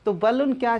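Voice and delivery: usual speaking voice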